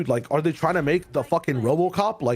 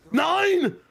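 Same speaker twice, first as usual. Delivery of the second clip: shouted caster callout; 12 semitones higher than usual. Acted. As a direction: bellow it out full force. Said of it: "I don't think he says the nine like that".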